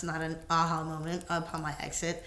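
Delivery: ordinary delivery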